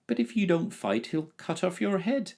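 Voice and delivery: high, breathless alarm; gasped. A narrator's reading. Boy gasping to the dragon in alarm, high. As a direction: gasp it out in worry. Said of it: "gasped the boy".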